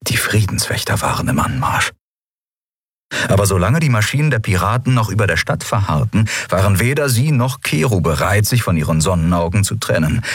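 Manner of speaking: ordinary delivery, nothing marked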